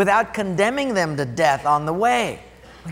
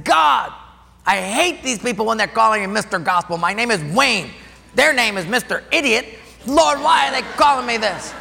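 angry prayer; 4 semitones higher than usual. Retold or imitated. Youth pastor venting to God in the car, frustrated shout. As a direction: vent the anger, pounding the wheel. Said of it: "I was so mad".

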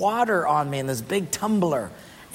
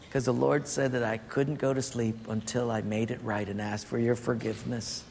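Mike's meek confession; 7 semitones lower, 8 dB quieter than usual. Retold or imitated. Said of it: "Mike confessing".

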